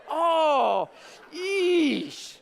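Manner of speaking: high, drawn-out "oh, yeesh"; imitating onlookers wincing in sympathy; long pained groan of secondhand embarrassment; cringing groan.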